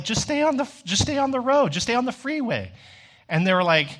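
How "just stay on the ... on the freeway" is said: high-pitched, exasperated instruction; exasperated advice. Retold or imitated. Kid tells lost parent to stay on freeway, exasperated. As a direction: tell them the obvious, exasperated. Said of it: "I was like".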